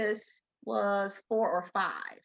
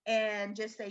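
usual speaking voice

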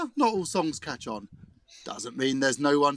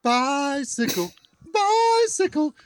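much higher, slow rising excitement; excited commentary; 3 dB louder than usual. Acted. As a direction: call it like a thrilled commentator.

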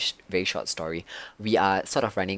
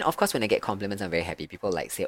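ordinary talk

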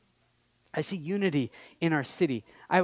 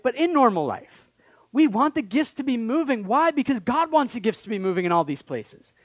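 loud, rising plea for more gifts; fervent preaching; 6 semitones higher, 6 dB louder than usual. Acted. Pastor urging his church to hunger for gifts, loud fervent preaching. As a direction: preach it out hard to the congregation.

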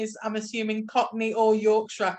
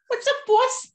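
high put-on gangster voice, loud; hammed-up old movie henchman greeting; 1920s gangster underling; 14 semitones higher, 5 dB louder than usual. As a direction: mimic a Prohibition-era sidekick. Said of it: "That kind of guy".

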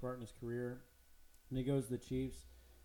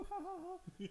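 hammed-up laugh, much higher; mock teammate laugh; 3 dB quieter, slower than usual. Players laughing at his modesty, as a hammed-up high laugh. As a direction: cackle like a smug teammate. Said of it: "all the players are like".

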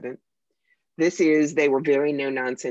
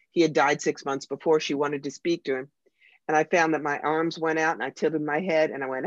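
normal talking voice

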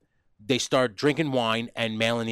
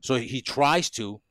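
normal talking voice

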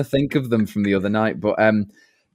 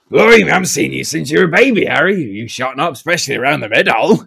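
louder, fast and hearty West Country giant; Hagrid impression; 3 semitones higher, 5 dB louder than usual. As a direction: rattle it off like a jolly big groundskeeper. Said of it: "You Hagrid!".

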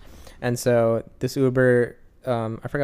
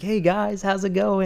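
bright, much higher mock-friendly hello; cheery driver greeting; 8 semitones higher, slower than usual. Imitating the driver greeting his riders, a high chirpy hello. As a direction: put on the eager driver's welcome.

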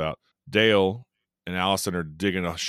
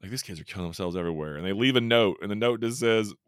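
ordinary talk, nothing marked

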